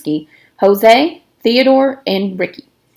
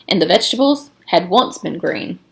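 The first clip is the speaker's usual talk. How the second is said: ordinary delivery, nothing marked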